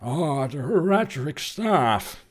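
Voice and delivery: put-on very old sage, slow and drawn out; Deckard Cain. Shown as a demonstration. Streamer doing a Kane Deckard impression, slow old-man voice. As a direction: stretch it out like an ancient Connery. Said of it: "I can do a pretty good Kane Deckard".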